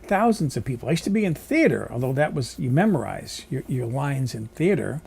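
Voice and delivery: ordinary delivery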